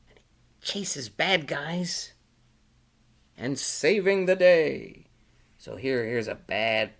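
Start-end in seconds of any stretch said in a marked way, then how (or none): 0.1-0.2 whispering. A narrator's reading. Storyteller whispers line. 3.4-5.1 heroic. A narrator's reading. Heroic climax of story.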